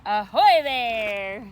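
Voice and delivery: loud, slow pirate's hail; pirate shout. Acted. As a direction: call it like a pirate from the bow.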